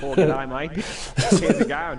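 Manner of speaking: loud, hammed-up Australian greeting; Australian accent. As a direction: boom it out like a jolly Aussie mate. Co-host greets in Aussie accent.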